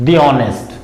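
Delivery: "the honest" run together as dee-onest; long ee in "the", no h in honest; "the" as thee. Shown as a demonstration. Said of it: "तो कैसे pronounce करेंगे".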